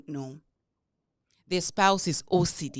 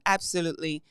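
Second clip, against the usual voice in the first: usual speaking voice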